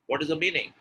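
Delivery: ordinary delivery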